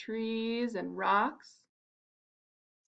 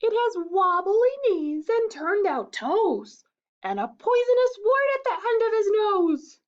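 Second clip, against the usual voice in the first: mouse spooking fox; 11 semitones higher, 6 dB louder than usual. Acted. Mouse scares the fox, high and dramatic. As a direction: make the monster sound dreadful.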